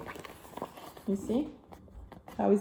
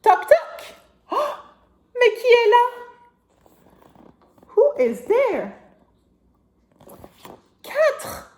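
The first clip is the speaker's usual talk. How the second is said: knock knock voice; 11 semitones higher, 6 dB louder than usual. Acted. Knock-knock game, loud.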